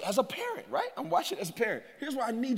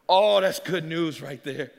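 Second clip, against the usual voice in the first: ordinary talk, nothing marked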